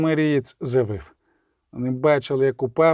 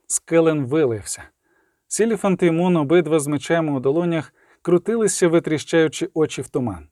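usual speaking voice

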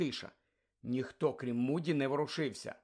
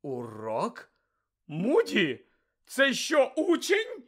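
squealing; 9 semitones higher, 6 dB louder, much slower than usual. Acted. McGonagall horrified the ferret is a student, rising squeal. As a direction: voice climbing to a shrill squeal. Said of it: "вирискнула професорка".

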